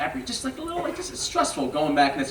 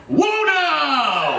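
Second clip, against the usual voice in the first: startled scream; 10 semitones higher than usual. Retold or imitated. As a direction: blurt it out like reining in a horse. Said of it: "at the top of my lungs, I screamed".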